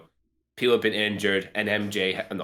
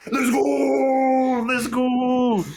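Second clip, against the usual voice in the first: high, loud hype yell; fan scream; 13 semitones higher, 6 dB louder, much slower than usual. Retold or imitated. Retelling his own cinema scream, loud and high. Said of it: "I was literally like".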